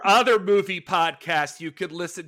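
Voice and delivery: high, loud declaiming; overacted movie character. Host scorning other movie podcasts, loud and overacted. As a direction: push it up and out, hammed-up.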